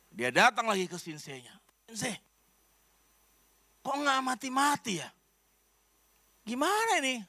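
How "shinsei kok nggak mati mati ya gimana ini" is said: whiny complaint. Retold or imitated. Wife complains poison isn't working.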